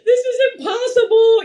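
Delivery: high, loud, tearful despair; overwhelmed new mom crying to her mother; retold tearful wail. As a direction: wail it like you're at the end of your rope. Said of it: "calling my mom crying".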